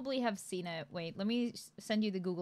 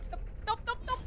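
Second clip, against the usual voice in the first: high panicked stop. Real, not acted. Panicked in game, yelling stop.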